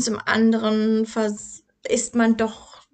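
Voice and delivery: usual speaking voice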